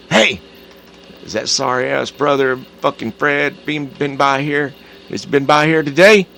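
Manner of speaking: angry interrogation. Retold or imitated. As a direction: grill her about the brother, loud. Ted interrogating wife about brother.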